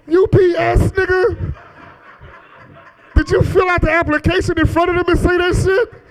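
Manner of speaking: high-pitched incredulous scolding; comic outraged questioning; exasperated disbelief. Retold or imitated. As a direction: correct a clueless friend, pitch way up. Exasperated correcting of friend's mistake.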